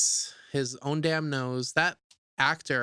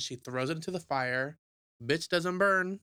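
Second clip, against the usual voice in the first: normal talking voice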